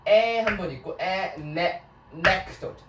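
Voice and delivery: "anecdote" with loud stress on the first syllable; stress on ANE. Shown as a demonstration. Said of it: "강세가 어디 있어요".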